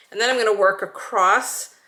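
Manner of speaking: normal talking voice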